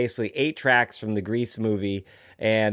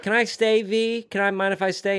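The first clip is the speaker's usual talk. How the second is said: put-on higher, timid girl's voice; Frenchie imitation; 6 semitones higher than usual. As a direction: ask shyly like a hopeful teenager. Frenchie quoted asking the waitress.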